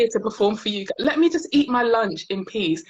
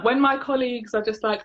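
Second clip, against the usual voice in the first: ordinary delivery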